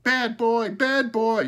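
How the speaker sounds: high, loud crow cries; shrieky bird voice, high and loud; talking crow. A narrator's reading. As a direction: squawk it loudly from the treetop. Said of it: "Smokey cried again and again".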